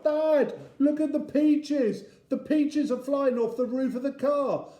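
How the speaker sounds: put-on loud, very high child's alarm call. A narrator's reading. Joe calling to Dad about the peaches, high calling out. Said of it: "Joe is calling out".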